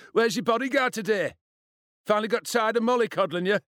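high, mocking bully voice; taunting. A narrator's reading. Bully mocks the boy about his bodyguard, taunting. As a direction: needle him with loud, high questions. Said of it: "Gavin Hurst taunted".

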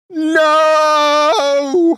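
long, high, loud howl; wolf howl. A narrator's reading. Wolf thwarted by the lamb's kiss, howling. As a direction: howl it out at full stretch. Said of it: "howled the wolf".

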